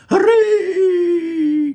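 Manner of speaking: high, loud cheer of relief; joyful cry. Acted. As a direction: cry out in delight. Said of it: "cried Mister Wonka".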